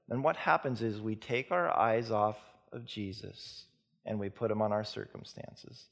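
ordinary delivery

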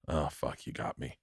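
low, soft retold admission, fading to almost nothing; quiet concession. Retelling a friend caught out about his dad, quiet concession. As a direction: concede it under your breath, imitating a friend.

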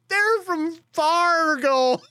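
very high, drawn-out retold explanation; high strained plea. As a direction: imitate helpless near-laughing excuse. Retold helpless laughter at Fargo callers.

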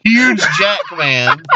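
slow, laughing correction. Real, not acted. Correcting, slowed down.

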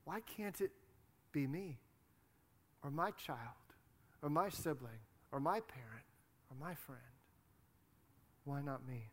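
soft, halting plea to God; pleading prayer. Retold or imitated. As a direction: voice someone's hushed prayer, with long pauses. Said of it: "and you say".

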